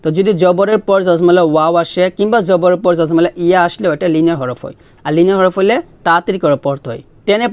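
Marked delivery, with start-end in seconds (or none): none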